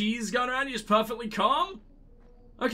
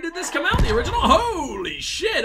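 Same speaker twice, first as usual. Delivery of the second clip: laughing in amazement; 8 semitones higher, 7 dB louder than usual. Real, not acted. Amazed by the game's mirror.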